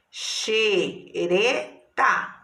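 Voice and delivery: "Xereta" slowly split as xe, re, ta; three drawn-out beats on "Xereta"; syllable by syllable. Shown as a demonstration. Teacher separating syllables for pupils, slow beats.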